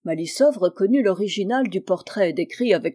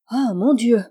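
louder burst of mild alarm; startled exclamation; 3 semitones higher, 6 dB louder than usual. A narrator's reading. Old friend seeing him walk in, exclaiming. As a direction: cry out in surprise on seeing him. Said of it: "s'écria celle-ci en le voyant entrer".

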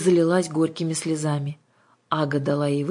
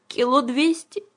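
put-on high child's sigh; small, wistful girl's voice; sighing correction; 10 semitones higher, 4 dB louder, slower than usual. A narrator's reading. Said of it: "вздыхая".

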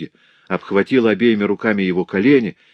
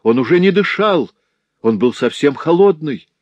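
desperate pleading; 6 semitones higher, 5 dB louder than usual. A narrator's reading. Louise swearing to her father the baby was dead, desperate plea. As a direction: beg them to believe you, near breaking. Daughter pleading innocence, distraught.

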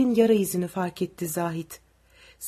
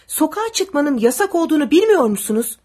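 angry demand; 6 semitones higher, 7 dB louder than usual. A narrator's reading. Soldier scolds curfew breakers.

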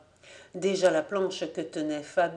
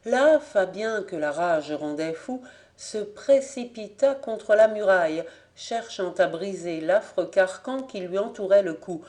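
usual speaking voice

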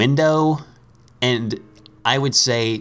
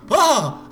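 high, sudden pretend yelp of fright; mock startled yelp. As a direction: yelp as if caught off guard. Startled by stranger.

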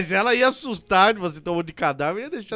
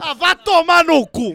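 mock outraged yell; 8 semitones higher, 6 dB louder, slower than usual. Mock outrage at friend's answer.

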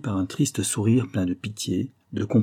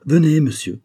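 loud, raised summons; calling out; 6 semitones higher, 6 dB louder than usual. A narrator's reading. Count calls the stranger in to dinner, loudly. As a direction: call the guest over, high and forceful. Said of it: "s'écria le comte".

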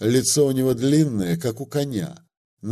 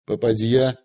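ordinary delivery

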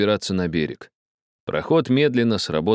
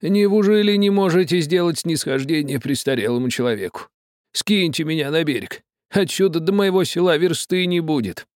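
high-voiced pleading old man; old man begging; 10 semitones higher than usual. A narrator's reading. Old man begging the captain to drop him ashore, high and pleading. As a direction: wheedle the captain for a favour. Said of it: "говорил старик".

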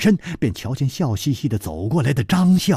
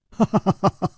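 high merry laugh into speech; 6 semitones higher, faster than usual. Acted. Zhang Xiaolin up on the eaves dodging a fight, laughing. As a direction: laugh heartily, then talk on brightly. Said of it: "笑道".

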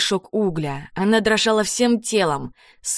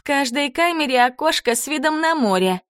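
high, sugary taunting voice; sweetly mocking jailer; 8 semitones higher than usual. A narrator's reading. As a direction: savour the cruel little remark. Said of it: "заметила Карабелла".

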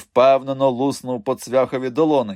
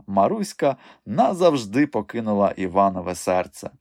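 usual speaking voice

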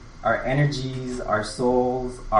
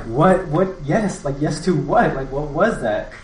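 excited confusion; 5 semitones higher, 3 dB louder than usual. Retold or imitated. Retold pressing friend for answer.

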